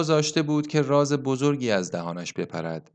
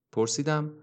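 plain reading voice

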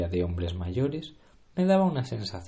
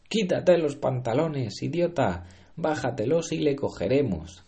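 impatient shout; 6 semitones higher, 3 dB louder than usual. Acted. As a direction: shout it at the fool. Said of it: "gritó alguien".

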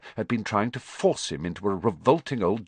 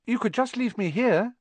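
hopeful. A narrator's reading. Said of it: "Harry put in hopefully".

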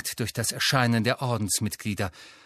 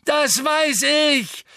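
much higher, louder slow roar; Neville roaring; 14 semitones higher, 5 dB louder, slower than usual. A narrator's reading. Neville raging at Bellatrix, furious roar. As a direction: roar back in rage. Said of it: "brüllte Neville".

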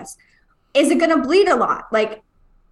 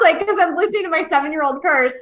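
ordinary talk, nothing marked

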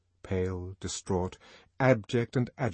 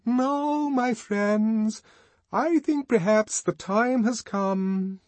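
much higher, louder, ringing orator; 13 semitones higher, 5 dB louder, much slower than usual. A narrator's reading. Songster addressing the party guests, ringing orator voice. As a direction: ring out like leading a celebration. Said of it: "in that beautiful ringing voice".

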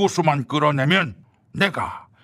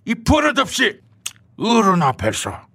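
indignant scolding; 7 semitones higher than usual. Acted. Old politician scolds younger rival.

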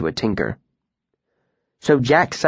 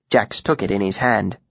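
ordinary delivery

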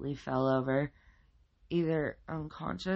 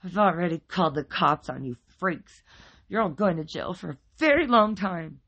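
loud, raised, indignant voice; angry threat; 5 semitones higher, 9 dB louder than usual. A narrator's reading. Wife warns neighbors the cops are coming, angry. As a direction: threaten them with real heat.

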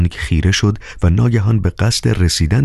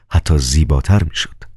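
ordinary delivery, nothing marked

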